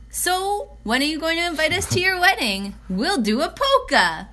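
high-pitched, excited imitation of nosy new friends; playfully imitated eager wedding-guest enthusiasm; eager questioning. Imitating eager women asking about wedding.

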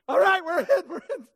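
high, loud hasty giving-in; panicked surrender. Acted. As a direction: surrender fast before the stick drops. Scared listener gives in.

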